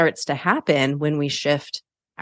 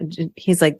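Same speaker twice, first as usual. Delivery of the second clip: usual speaking voice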